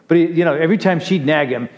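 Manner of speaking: ordinary delivery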